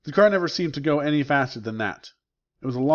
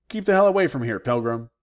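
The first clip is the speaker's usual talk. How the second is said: angry shout; 4 dB louder than usual. Acted. A prisoner yells at Billy to keep away, loud and furious. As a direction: drive him off with a shout.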